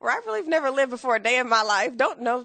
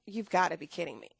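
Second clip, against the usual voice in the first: low, soft and fast, stunned; stunned disbelief; 4 semitones lower, 6 dB quieter, faster than usual. Retold or imitated. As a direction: mutter it in shock. Reacting to being fired.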